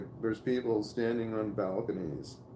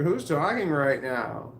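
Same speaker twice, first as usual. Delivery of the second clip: retold demand; 4 semitones higher, 5 dB louder, slower than usual. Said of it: "when I was just like".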